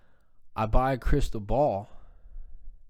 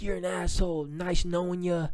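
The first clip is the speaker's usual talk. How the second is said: imitating angry dad; 7 semitones higher than usual. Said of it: "And my dad's like".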